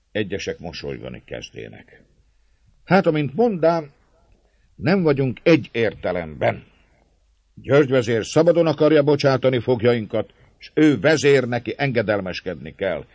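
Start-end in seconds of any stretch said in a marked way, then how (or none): 2.8-13.0 magas, hangos szónoki hang; szólj derűsen az egész tömeghez; jókedvű népszónok. A narrator's reading. (tréfás népszónokként, emelt hangon)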